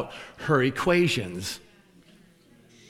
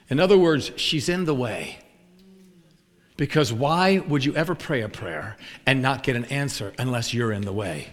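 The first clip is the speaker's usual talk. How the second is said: ordinary talk, nothing marked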